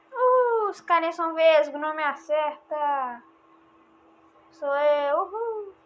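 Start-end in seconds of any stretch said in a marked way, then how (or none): none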